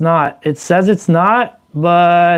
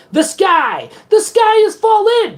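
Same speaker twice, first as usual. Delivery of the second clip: the sky, rising higher with each repeat; building panic cry; 13 semitones higher than usual. Pretend. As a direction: climb higher and higher into mock panic. Chicken little rant, pitch climbs.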